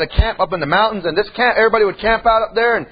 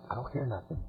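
hushed; 10 semitones lower, 20 dB quieter than usual. Retold or imitated. Kids lost in woods, listening.

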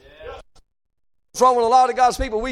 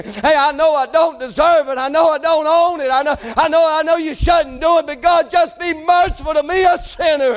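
high, rising preacher's cry; impassioned plea; 6 semitones higher than usual. Acted. Sinner begging God for undeserved mercy, impassioned.

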